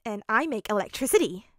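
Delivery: put-on eager boy showing off his power; excited. A narrator's reading. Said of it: "Denki informed him excitedly".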